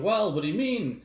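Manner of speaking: ordinary delivery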